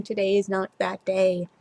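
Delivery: ordinary delivery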